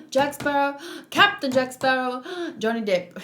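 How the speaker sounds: theatrical gasp before each name; breathless fan act, gasping between names; hammed-up awe at famous names; mock starstruck gasping. Repeats gasping bit with the names.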